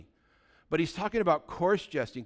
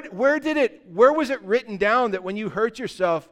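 usual speaking voice

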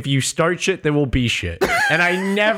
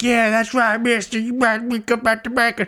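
higher-pitched mock-cowboy jeering; mock taunt; 7 semitones higher than usual. Retelling his game fights, mock taunting. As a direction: taunt like a cocky outlaw, pitched up.

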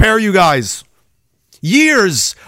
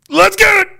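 high, loud hype yell; hype shout; 13 semitones higher, 3 dB louder than usual. Real, not acted. Streamer pumping up his viewers before the clip, loud hype yell. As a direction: fire the crowd up before the bell.

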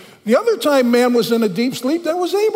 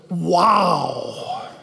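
a lower, amazed wow, calling out; awed exclamation; 5 semitones lower than usual. Retold or imitated. Preacher voicing Adam seeing the woman, amazed wow. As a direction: let the wonder burst out of Adam. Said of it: "read it the way that I learned it in Hebrew".

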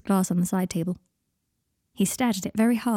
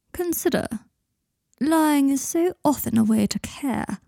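higher, unhurried, musing friend; a sly, thoughtful confidant voice, slowing at the end; Blaise's voice; 6 semitones higher than usual. A narrator's reading.

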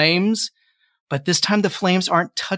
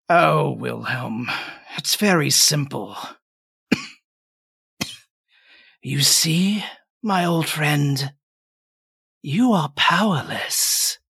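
put-on slow, frail, dying old man; weak voice; slower than usual. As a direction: speak like a man breathing his last. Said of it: "a very weak voice".

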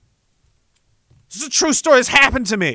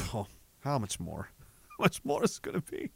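much lower, much softer weary groan; weary soul; 9 semitones lower, 16 dB quieter than usual. Retold or imitated. Tired soul groaning deep down about how much more. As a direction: let your tired soul moan it. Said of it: "part of me really deep down is like".

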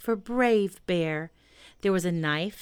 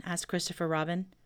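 ordinary delivery

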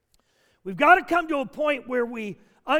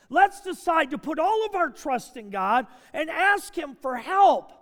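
higher, insistent pulpit voice; forceful preaching; 6 semitones higher, 3 dB louder than usual. Real, not acted. Pastor rebutting the cliche objection and urging war on words, forceful preaching. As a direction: drive it home with emphatic, stretched phrases.

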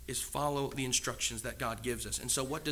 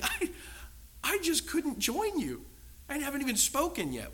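much higher, pleading inner voice; mock plea; 11 semitones higher than usual. The believer thinking to his accusers, high mock plea. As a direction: protest helplessly in your head.

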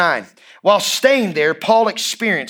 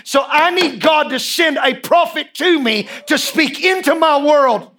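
desperate cry, pitched up and urgent; 7 semitones higher than usual. Acted. Preacher voices the Macedonian man begging for a prophet, crying out. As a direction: cry it out like a man at the end of his rope. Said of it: "A man that was crying out".

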